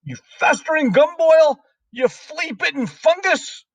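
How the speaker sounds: very high, strident headmistress rant; shouted tirade. A narrator's reading. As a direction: berate the pupil at a high, furious pitch. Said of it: "shouted the Trunchbull".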